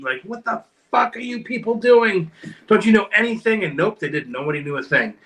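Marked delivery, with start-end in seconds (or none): 0.2-3.6 mock yell at the TV, higher-pitched; retold yell at TV. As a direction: bark it at the screen like a fed-up fan. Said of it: "I would scream at the T V".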